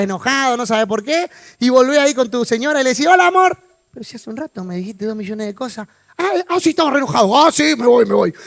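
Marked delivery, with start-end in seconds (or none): 3.1-3.6 higher, louder sweet hello; acted loving greeting. Husband going back to his wife after the phone, sweet greeting. 3.9-5.9 much softer, puzzled comeback; acted quiet reproach. Wife answering her suddenly affectionate husband, quiet reproach. Wife's reply in acted exchange. 6.2-8.3 mock remembering you were cross and leave; acted huffy retreat. Husband reminded of the quarrel by his wife, mock huffy.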